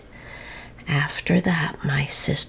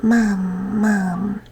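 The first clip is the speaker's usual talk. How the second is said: put-on baby voice; 4 semitones higher, 4 dB louder than usual. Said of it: "my sister was saying".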